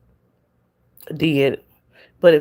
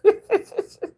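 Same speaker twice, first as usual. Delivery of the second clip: high squeal of laughter. Real, not acted. Laughs at the story.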